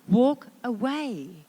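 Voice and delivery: usual speaking voice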